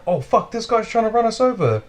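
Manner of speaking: high, startled yell of alarm; alarmed shout. Retold or imitated. Teller reliving the van attack, high alarmed yell. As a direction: cry out as the van comes at you.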